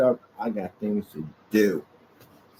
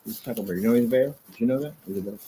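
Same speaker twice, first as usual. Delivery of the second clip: ordinary talk, nothing marked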